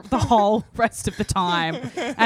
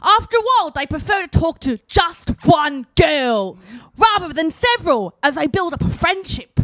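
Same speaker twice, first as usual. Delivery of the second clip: higher, louder put-on UK reading voice; 7 semitones higher, 5 dB louder than usual. Reading the magazine to friends in a higher, louder put-on UK voice. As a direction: read it like a pompous British reporter. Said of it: "before she starts talking the U K".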